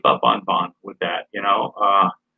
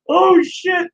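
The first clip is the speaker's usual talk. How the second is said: surprised; 6 dB louder than usual. Real, not acted. Laughing surprise at the name.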